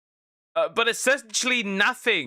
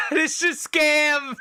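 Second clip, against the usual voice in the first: laughing; 7 semitones higher, 4 dB louder than usual. Real, not acted. Laughing at the scam game.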